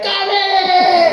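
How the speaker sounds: high, drawn-out outraged shriek; top-of-lungs scream. Retold or imitated. Schoolboy discovering his sandwich filling, screaming at the top of his lungs. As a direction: imitate a boy screaming his head off. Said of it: "he'd scream at the top of his lungs".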